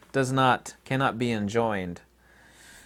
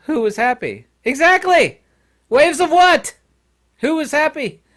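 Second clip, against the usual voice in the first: loud, high-pitched comic bickering; mock shouting match; 14 semitones higher, 9 dB louder than usual. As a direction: shout both sides of a silly argument. Acted argument reaches its peak.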